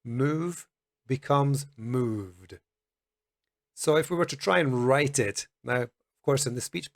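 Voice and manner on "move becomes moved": slow, careful move becomes moved; slow demo. Shown as a demonstration. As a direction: stretch out moved with a voiced d. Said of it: "So we want to say delivered".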